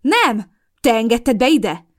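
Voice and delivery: loud, high heated exchange; loud quarrelling; heated quarrel. A narrator's reading. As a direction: fire the accusations. Heated accusation and denial.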